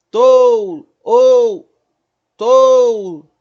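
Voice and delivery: "ou" bem forte e agudo, O alongado caindo no U; vogal forte sustentada. Shown as a demonstration. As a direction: segure o O de "tou" e solte o U. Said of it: "aquela que se sustenta mais". ("tou" esticado, do forte pro fraco)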